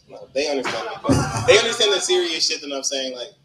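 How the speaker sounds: usual speaking voice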